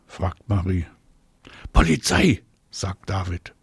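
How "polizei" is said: high, loud burst; eager suggestion. A narrator's reading. David proposes a game to his friends, eager. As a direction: throw out the idea eagerly.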